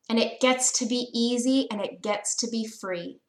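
usual speaking voice

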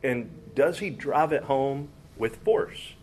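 usual speaking voice

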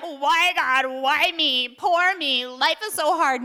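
mock whining. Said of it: "I was whining and complaining".

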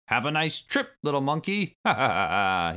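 high taunting villain laugh; evil laugh. A narrator's reading. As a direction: jeer and cackle gleefully. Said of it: "he laughed".

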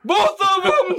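high gleeful exclamation; triumphant high cry. Acted. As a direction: crow it out in glee.

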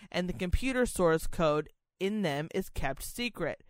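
usual speaking voice

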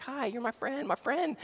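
put-on high, soft, sing-song toddler greeting; little girl voice. Pastor voicing his toddler saying hi to a cashier, high and soft. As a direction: imitate a tiny girl chirping hello.

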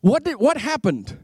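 normal talking voice

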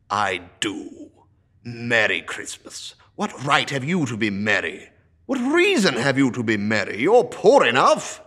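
put-on loud, scornful old miser; a miser sneering at cheer; gruff old Scrooge. Scrooge voiced, grumbling.

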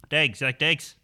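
put-on Pikey accent, savouring the vowel; Brad Pitt. Co-host demonstrates the film line, mock Pikey accent. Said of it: "Just channel Brad Pitt".

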